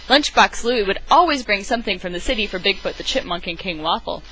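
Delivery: plain reading voice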